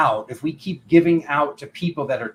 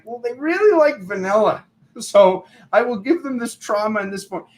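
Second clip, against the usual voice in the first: high, louder, sing-song enabling tone; mock people-pleaser; 8 semitones higher, 4 dB louder than usual. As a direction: lightly parody someone spoon-feeding comfort. Mocking people-pleaser's reasoning.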